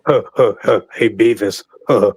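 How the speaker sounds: low snickering cartoon teen; Butt-Head voice. Beavis and Butt-Head impression.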